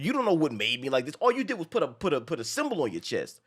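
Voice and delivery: ordinary talk, nothing marked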